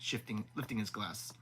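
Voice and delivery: usual speaking voice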